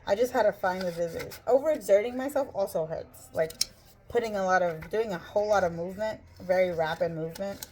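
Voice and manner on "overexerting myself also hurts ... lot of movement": ordinary talk, nothing marked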